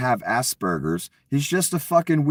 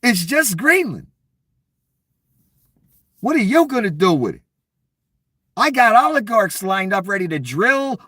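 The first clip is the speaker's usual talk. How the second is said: mock furious Donnie; 8 semitones higher than usual. Mimicking Donnie raging at Denmark's leader, hammed-up and high. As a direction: ham up a spoiled strongman throwing a fit. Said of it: "Donnie flipped the fuck out".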